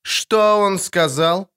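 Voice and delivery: high, drawn-out curiosity; interested question. A narrator's reading. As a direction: ask with piqued interest. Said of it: "заинтересовалась Шьейнас".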